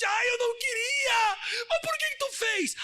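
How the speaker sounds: high, wailing fake sobs; hammed-up tearful, high-pitched wail; mock crying. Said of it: "o escorpião começou a chorar e disse".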